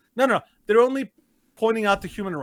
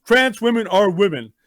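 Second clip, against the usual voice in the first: mocking a slogan, louder; 2 semitones higher, 6 dB louder than usual. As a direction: declaim it like a smug slogan. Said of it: "the N H L's response".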